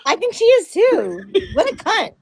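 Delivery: high laughing talk. Real, not acted. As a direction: laugh through the words. Laughing at the joke.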